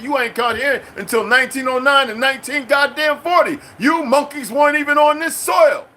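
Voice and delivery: high, loud furious tirade; angry rant. Real, not acted. Ranting at Republicans, high and furious. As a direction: tear into them, voice climbing high.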